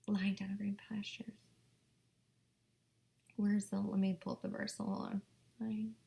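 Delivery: quiet searching whisper; soft distracted whisper; muttering whisper. Real, not acted. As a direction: whisper to yourself while scrolling. Searching verse, hushed.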